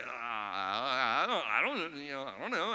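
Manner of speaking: slow, halting stammer; stuttering. Retold or imitated. Caught by the coach, he stammers for an excuse. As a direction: stumble over the words, caught out. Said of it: "he's stuttering all over himself".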